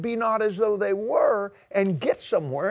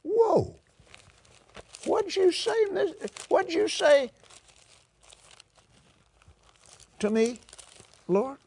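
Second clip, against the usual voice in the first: surprised; 9 semitones higher than usual. Pretend. Acts surprised hearing the Lord.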